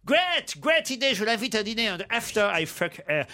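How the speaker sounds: mock an English toff stumbling over French; hammed-up English accent. English aristocrat answering his friend's advice, in a hammy English accent. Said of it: "Il prend l'accent de Laurel et Hardy".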